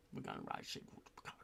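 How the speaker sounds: quick conspiratorial whisper. Pretend. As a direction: let them in on the secret, fast and hushed. Conspiratorial aside about the writers.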